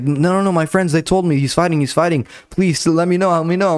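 high, eager insisting; insistent fan. Retold or imitated. Pharmacy lady insists her fighter's on.

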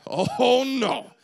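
mock threat. Crowd threatening Stephen.

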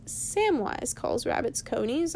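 plain reading voice

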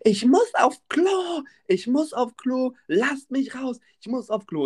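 high, drawn-out mock scream; Jacqueline screaming. Woman demanding to be let out, mock screaming. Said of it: "Jacqueline schreit die ganze Zeit".

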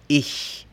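ich, ch hissed forward; German ich sound. Shown as a demonstration. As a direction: whisper the ch of ich like a smiling sh. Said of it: "un sonido que tú no conoces".

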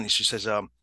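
ordinary talk

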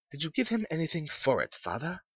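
high, quick woman's voice; inquisitive wife. A narrator's reading. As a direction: question the husband quickly, pitched well up. Wife questions her husband.

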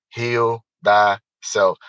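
"Heal thyself" drawn out very slowly, each word weighted; slow emphatic motto. Real, not acted. Sealing his story for the listener, slow emphatic motto.

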